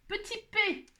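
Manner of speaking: high pitched "Petit P"; mock announcement. Real, not acted. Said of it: "quiz answer".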